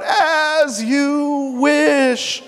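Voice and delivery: high, very slow, stretched out; drawn-out cry. Acted. Masked man calling to the princess while falling, high and drawn out. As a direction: let it ring out as he falls. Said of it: "and shouts out".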